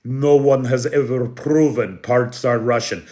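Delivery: put-on lightly done Russian accent reading a troll comment; mock a Russian bot in a Russian accent. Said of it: "I was gonna do the accent".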